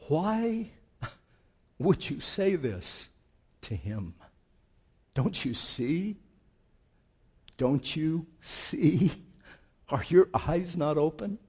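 slow, searching plea from the cross; pleading rebuke. Acted. Dying thief rebuking the mocking robber. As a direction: drag out don't you see.

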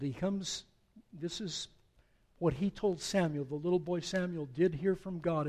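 usual speaking voice

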